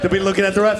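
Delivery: ordinary talk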